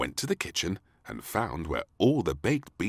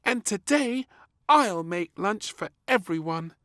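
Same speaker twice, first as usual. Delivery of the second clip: put-on high, loud eager girl; little princess; 6 dB louder than usual. A narrator's reading. Princess offers to cook lunch for all.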